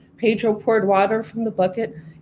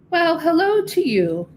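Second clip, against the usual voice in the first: higher, lilting friendly cow greeting; a gentle cow saying hello; 8 semitones higher than usual. Acted. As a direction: greet him as a kindly farm cow.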